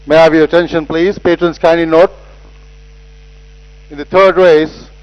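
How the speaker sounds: ordinary delivery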